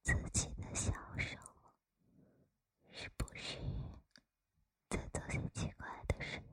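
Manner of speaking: very soft close whisper; right in your ear. Acted. Teasing the listener up close.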